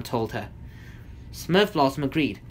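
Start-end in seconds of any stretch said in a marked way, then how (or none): none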